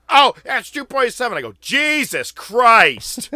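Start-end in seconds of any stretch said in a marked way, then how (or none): 0.1-1.4 high, loud mocking fan voice; whiny kid calling the count; mock annoying fan. Mocking the kid calling out the count. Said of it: "If he's like". 1.6-3.3 very slow, louder exasperated groan; exasperated sigh. Retold or imitated. Griping loudly at the annoying kid beside him. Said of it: "this is my known thing".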